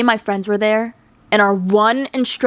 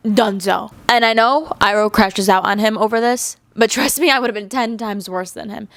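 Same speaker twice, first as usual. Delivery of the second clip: ordinary delivery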